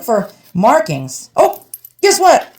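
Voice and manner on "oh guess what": high, slow, thrilled reveal; delighted he hit the jackpot; excited discovery. Real, not acted. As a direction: let the find burst out of you. Finding the silver mark.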